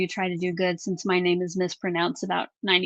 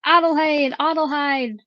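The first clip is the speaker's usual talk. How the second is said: raised-pitch German pronunciation of Adelheid; German accent; 5 semitones higher, 3 dB louder than usual. Shown as a demonstration. Host trying a winner's German name, in a German accent. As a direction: attempt your best German accent on Adelheid. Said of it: "Trying to get on my best German accent".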